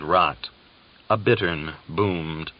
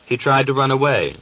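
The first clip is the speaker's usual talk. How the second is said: higher, louder snarl of an excuse; 7 semitones higher, 5 dB louder than usual. A narrator's reading. Uryupin answers the sergeant about the prisoner, snarling. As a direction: spit it out defiantly. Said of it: "Uryupin snarled".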